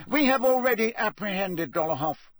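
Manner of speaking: stern official. A narrator's reading. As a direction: interrogate crisply. Crouch to prisoner.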